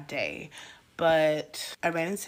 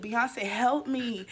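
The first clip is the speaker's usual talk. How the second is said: mock pleading; 7 semitones higher, 4 dB louder, much slower than usual. Beaten, pleading up at [name].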